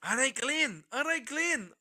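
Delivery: lightly imitating a Scottish mate's greeting; "All right, Glenn" in a mild mock Scottish accent. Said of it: "in his Scottish accent".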